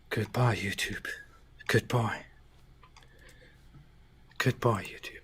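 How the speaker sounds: soft, near-whispered goodbye; hushed farewell. Pretend. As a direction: say farewell softly, trailing into a whisper. Mock farewell to the site.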